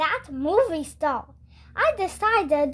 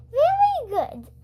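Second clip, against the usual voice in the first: higher, brighter nurse voice, quick; a cheery school nurse asking; lightly voiced friendly nurse; Missus Cooney voice; 8 semitones higher than usual. A narrator's reading.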